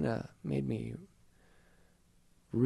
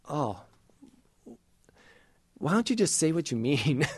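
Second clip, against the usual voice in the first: mock exasperation, a bit higher and louder; exasperated; 4 semitones higher, 5 dB louder than usual. Reader teasing John's Gospel, mildly exasperated. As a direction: sigh it out at wordy scripture, half joking. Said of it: "I've always been like".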